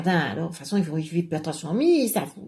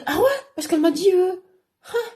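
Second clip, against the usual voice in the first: worked-up retelling; 10 semitones higher, 4 dB louder than usual. Acted.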